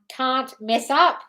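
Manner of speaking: "can't mess up" slowly, word by word, rising on "up"; slow, tentative "can't... mess... up?"; trying out a title. Real, not acted. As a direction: compose "can't mess up" aloud, slowly, with gaps.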